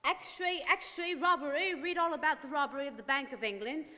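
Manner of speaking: high, loud street cry of headlines; newsboy calling out. Acted. Newsboy shouting the bank robbery headlines in the street, loud and high. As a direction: hawk the papers to passersby.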